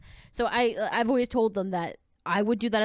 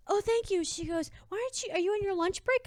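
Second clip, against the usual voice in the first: customer voice; 11 semitones higher than usual. Retold or imitated.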